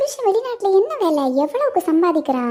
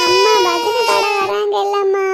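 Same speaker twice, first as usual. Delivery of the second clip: slow, high, wailing plea; whining cry; 4 semitones higher, slower than usual. Acted. Daughter wailing to her mother about looking pretty. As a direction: wail it like a spoilt girl.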